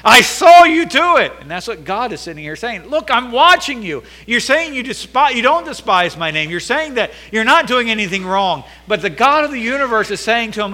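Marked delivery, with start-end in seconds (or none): 0.0-1.3 high, loud scolding shout; angry parent cutting off a child's denial; exasperated parent shout. Retold or imitated. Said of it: "grab 'em and shake 'em". 2.9-8.6 higher, louder, stern rebuke; God rebuking priests who deny wrongdoing, loud and stern; stern God scolding. Retold or imitated.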